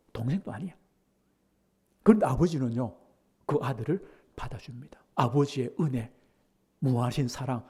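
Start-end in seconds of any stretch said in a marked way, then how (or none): 0.1-0.7 muttered echo. Retold or imitated. Repeated softly. 3.5-5.0 soft unvoiced whisper; gentle whispered aside. Real, not acted.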